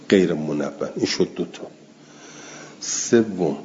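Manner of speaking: ordinary talk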